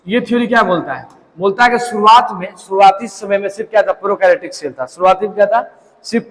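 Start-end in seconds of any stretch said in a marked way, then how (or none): none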